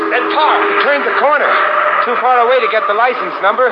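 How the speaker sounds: high, loud and fast, calling after a car; urgent shouting. Acted. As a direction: shout it out while running.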